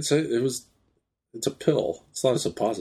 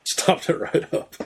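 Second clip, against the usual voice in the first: mock ad voice; 9 semitones higher than usual. Mock ad line for Duke Block.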